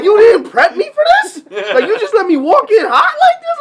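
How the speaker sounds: half-laughing, mock-offended complaint; retold outrage. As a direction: caught off guard at a baby shower, laughing indignation. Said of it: "so I'm getting mad".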